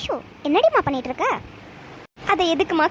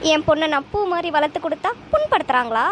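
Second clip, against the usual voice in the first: ordinary talk, nothing marked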